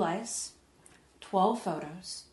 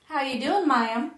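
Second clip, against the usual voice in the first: cheery, higher and louder put-on American accent; a friendly young pilot greeting in a hammed-up American accent; hokey American accent; 7 semitones higher, 9 dB louder than usual. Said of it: "so I can do a hokey American accent".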